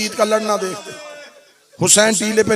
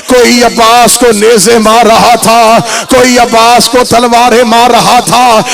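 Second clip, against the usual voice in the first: loud, chanted majlis recital; full-force preaching; 11 dB louder than usual. Acted. Preacher driving mourners to matam, loud chanting. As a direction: push the lament to its peak.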